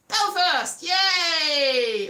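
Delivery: loud, high little cheer for Belfast; excited cheer. Real, not acted. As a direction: shout it out like a fan. Cheering a city name.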